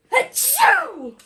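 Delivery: loud high squeaky achoo; big sneeze. Acted. Reader acts the flea sneezing, loud sneeze. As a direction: let the tiny flea explode into a sneeze.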